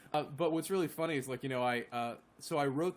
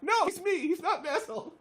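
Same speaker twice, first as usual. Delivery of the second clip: much higher, squeaky joking protest; mock protest; 3 dB louder than usual. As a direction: defend yourself in a high mock squeal. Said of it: "And I'm like".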